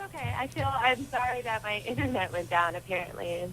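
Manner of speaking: very faint phone voice; whispery, far-off call audio; over a landline phone. Real, not acted. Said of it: "we can, like, barely hear you".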